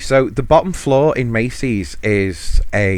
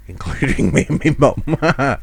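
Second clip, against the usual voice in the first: usual speaking voice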